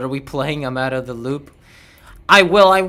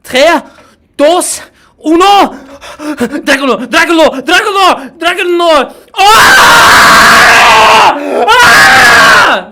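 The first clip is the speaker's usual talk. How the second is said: hyped unboxing YouTuber, countdown building to screaming; Own a Pixel impression; 10 semitones higher, 13 dB louder, slower than usual. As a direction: count down higher and louder until you scream. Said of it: "a very faithful recreation".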